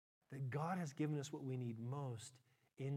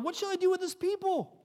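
desperate cry; 11 semitones higher, 10 dB louder than usual. Acted. Moses crying out to God.

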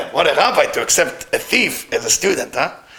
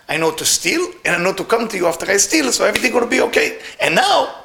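acted wail climbing from usual pitch to very high; crying plea; 7 semitones higher than usual. A narrator's reading. Thief lamenting on his dead master's grave, tearful and wailing. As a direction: plead higher and higher as if breaking into tears. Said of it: "start crying".

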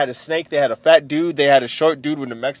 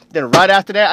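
usual speaking voice

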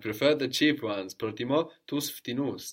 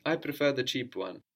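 ordinary delivery

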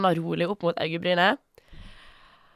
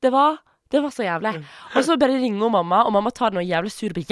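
usual speaking voice

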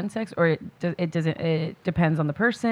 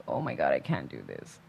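much lower, softer hungover croak; 10 dB quieter than usual. Retold or imitated. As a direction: imitate a wrecked hungover voice. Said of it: "my voice sounded so bad".

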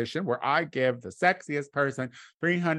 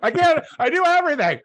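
higher and louder, exasperated; mock exasperated shout; 13 semitones higher, 6 dB louder than usual. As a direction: blow up in mock frustration. Mock outburst at cohost's criticism.

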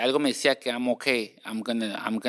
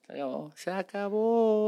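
sing-song sign-off; 6 semitones higher, 3 dB quieter than usual. Pretend. Echoing sign-off jokingly.

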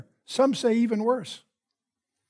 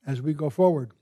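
quiet, unhurried prayer voice, a little softer than his teaching; 4 semitones lower, 3 dB quieter than usual. Acted. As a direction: pray earnestly to the Father before the lesson. Opening prayer before the study.

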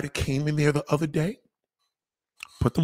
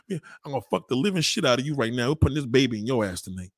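normal talking voice

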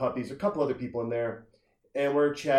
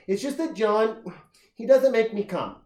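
imitating her in a much higher voice; whining bar girl; 10 semitones higher, 4 dB louder than usual. Girl confiding about John within earshot, mock high complaining voice. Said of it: "With a voice like that".

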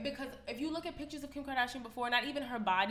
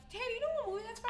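high, fast, fake-innocent voice; pretend-polite question to the boss, high and rushed; mock sweet question; 14 semitones higher, much faster than usual. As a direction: chirp it up high and quick as a sarcastic jab. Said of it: "I really wanted to be like".

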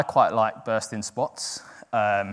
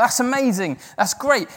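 high-pitched, upbeat reacted praise; enthusiastic approval; 10 semitones higher, 4 dB louder than usual. Preacher imitating his reaction to testimonies, enthusiastic approval. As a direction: act out eager delight, pitch lifted high.

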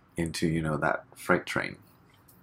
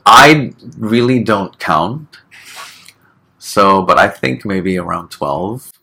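bashful laugh; 7 semitones higher, 14 dB louder, slower than usual. Real, not acted. Embarrassed about language count.

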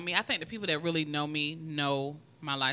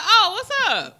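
high, loud, slow drawn-out fake-friendly hello; mock greeting; 13 semitones higher, 11 dB louder, slower than usual. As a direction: stretch it out in a sing-song put-on voice. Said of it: "I was like".

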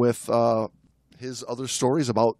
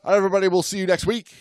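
rushed sign-off; 5 semitones higher, much faster than usual. Real, not acted.

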